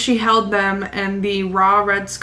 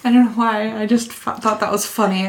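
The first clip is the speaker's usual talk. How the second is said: ordinary talk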